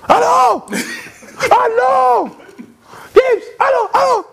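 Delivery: very high excited yell down the phone; high-pitched overexcited phone yell; Rossi shouting. Retold or imitated. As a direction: shriek it like an overjoyed boss. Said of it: "crier, hausser le ton".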